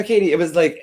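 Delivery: normal talking voice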